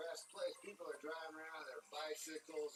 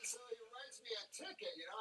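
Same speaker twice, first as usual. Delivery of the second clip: ordinary talk, nothing marked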